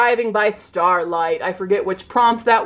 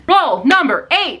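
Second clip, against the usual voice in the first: slow, high, louder call-out; drawn-out announcer voice; announcer roll call; 7 semitones higher, 5 dB louder, slower than usual. Real, not acted. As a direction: call the roll like a game show host.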